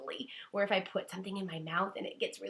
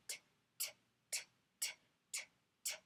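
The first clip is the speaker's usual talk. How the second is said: quiet unvoiced ticking t; tennis t; 10 dB quieter, much faster than usual. Shown as a demonstration. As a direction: tick t back and forth like a ball. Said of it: "my head kind of makes this sound".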